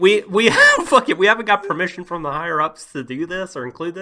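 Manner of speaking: loud, high, laughing mock defiance; defiant animators. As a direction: burst out like a reckless creator. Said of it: "people like Ikuhara and Yuasa that are just like".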